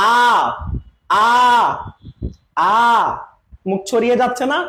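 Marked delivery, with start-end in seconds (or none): 0.1-2.8 teacher showing the spread আ; vowel demo. Shown as a demonstration. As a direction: open আ with the mouth spread wide. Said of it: "সবাই আমরা বলি".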